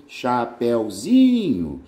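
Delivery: Chapeuzinho drawn out very slowly, stress landing on zi; stressed syllable zi. Shown as a demonstration. Teacher showing students the stressed syllable, very slow. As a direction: spell it out slowly and land on zi in Chapeuzinho. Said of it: "a sílaba tônica do diminutivo é o zi".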